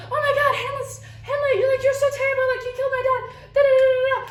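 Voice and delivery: high, frantic mock meltdown; hysterical Ophelia. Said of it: "she starts going crazy".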